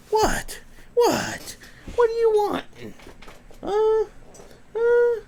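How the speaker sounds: very high put-on dog voice, startled. Owner voicing his just-woken dog, high put-on voice.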